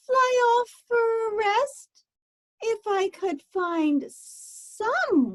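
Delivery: lazy whiny bird. Acted. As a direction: whine like a bored lazy bird stuck on her nest. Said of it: "Sighed Maisie, a lazy bird".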